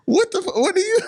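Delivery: high, incredulous "what are you"; shocked friend. Retold or imitated. Friend catching him petting dogs, high and shocked. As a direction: gasp it out in disbelief, way up high.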